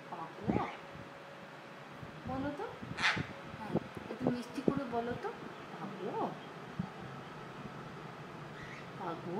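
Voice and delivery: hushed, cooing voice to a parrot; soft pet talk. Real, not acted. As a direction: coax the bird gently, softly. Talking softly to pet parrot. (পাখির সাথে নরম আদুরে গলায় কথা)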